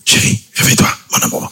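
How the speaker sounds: hushed wake-up whisper; whispering to wife. Acted. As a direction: whisper her awake gently. Waking wife softly on the plane.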